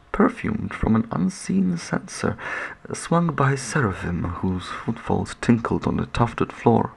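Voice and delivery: usual speaking voice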